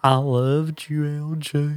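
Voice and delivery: slow, drawn-out mock Southern accent; exaggerated Southern accent. Mocking a horror movie character's voice, exaggerated Southern accent. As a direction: mimic an actress's fake Southern accent. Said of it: "God, I hate her accent".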